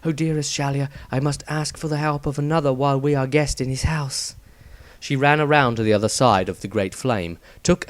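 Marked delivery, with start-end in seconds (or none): 0.0-4.3 prayer under breath. A narrator's reading. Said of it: "she prayed under her breath".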